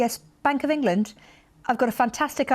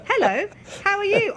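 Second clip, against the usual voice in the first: higher puppet voice for the toy; mascot voice; 10 semitones higher than usual. Pretend. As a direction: make the mascot say hello. Voicing toy mascots meeting.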